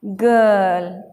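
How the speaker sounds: गर्ल as a very slow guhhl, no r; silent r. Shown as a demonstration. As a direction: stretch गर्ल, dropping the r. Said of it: "आर silent हो जाता है".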